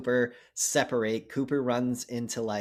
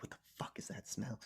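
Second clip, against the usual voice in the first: whisper; 3 semitones lower, 18 dB quieter than usual. Retold or imitated. Hiding in the wardrobe.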